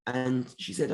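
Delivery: usual speaking voice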